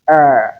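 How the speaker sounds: er said long and loud as the full vowel of her; long er sound. Shown as a demonstration. Teacher isolates the er vowel in her. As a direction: sound out er fully and firmly. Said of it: "This one we have".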